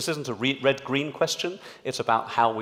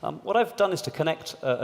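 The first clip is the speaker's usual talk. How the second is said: ordinary talk, nothing marked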